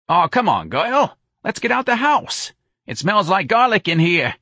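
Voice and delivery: high, loud coaxing; pushy New Orleans girlfriend; brash, impatient Santa. Acted. Santa urges her friend out of the house, brash.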